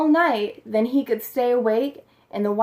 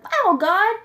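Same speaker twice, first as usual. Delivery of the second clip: high, eager owl voice, well above the narrator; a young owl talking to God, high and eager; owl's voice; 6 semitones higher, 3 dB louder than usual. A narrator's reading. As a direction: voice the owl keen to help. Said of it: "He said".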